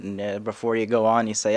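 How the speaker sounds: ordinary delivery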